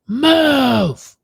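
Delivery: loud, high, drawn-out shout; mock road-rage holler; imitated angry yell. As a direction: yell it like an irate driver. Angry driver acted out.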